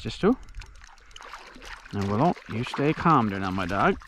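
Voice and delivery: ordinary talk, nothing marked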